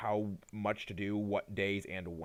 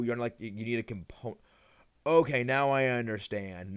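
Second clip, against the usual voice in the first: usual speaking voice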